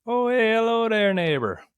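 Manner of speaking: high, loud cheery greeting; bright sing-song neighborly hello; video intro voice. Shown as a demonstration. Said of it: "what do I do when I say the beginning of those videos".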